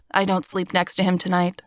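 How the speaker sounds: plain reading voice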